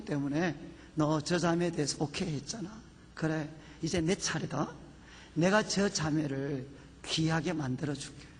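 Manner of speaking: low, softened, measured divine promise; voice of God. Retold or imitated. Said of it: "무슨 음성을 들었느냐?".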